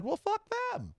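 high, sneering quiet retort; reading a text as a slight and brushing it off; offended scoff. Retold or imitated. Imagined reaction to a text.

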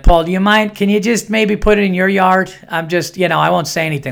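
polite neighbor. Acted. Neighbor asking Paul not to bury it in his yard, polite high voice.

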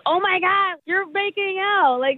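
high-pitched imitation of thrilled friends; excited retold reaction. Woman retelling friends watching the video, high excited mimicry.

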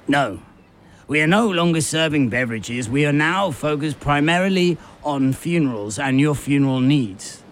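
deadpan shopkeeper reciting the new policy; kiosk man. Retold or imitated. Kiosk man tells the thirsty customer they only do funerals, put-on deadpan voice.

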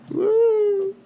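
very high, sustained woo; high "woo" cheer. Real, not acted. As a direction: let out a long high-pitched whoop. Cheer after saying Pride.

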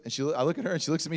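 ordinary talk

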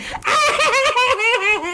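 high, hammed-up wail; exaggerated tantrum crying; mock bawling. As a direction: wail like a melodramatic child. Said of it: "falls in her bed and is like".

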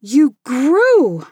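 put-on a child's startled yell. A narrator's reading. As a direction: blurt it out loudly in disbelief. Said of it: "I yelled".

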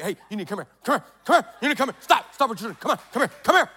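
mock frantic urgency; freaked out. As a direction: imitate a rattled teacher urgently summoning colleagues. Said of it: "she's, like, freaked out".

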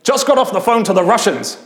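hammed-up Brian Blessed, fuming about the Russians; furious Brian Blessed. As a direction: rage like a wronged grand actor. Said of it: "he said".

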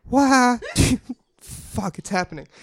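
usual speaking voice

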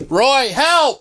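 high mock cry for help; Brian Posehn. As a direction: yelp it like a bad actor in a panic. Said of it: "but him going".

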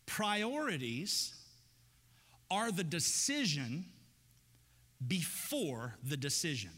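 slow, each phrase set apart with pauses; slow emphatic teaching. Shown as a demonstration. Preacher giving the congregation the key idea, slow and deliberate. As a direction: lay the key line down slowly. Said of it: "Think about this for a second".

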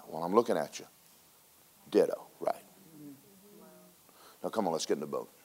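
hushed, gentle retelling of Jesus; soft and low, drawing Peter in; quiet Jesus voice. Retold or imitated. Jesus quietly coaxing Peter to think.

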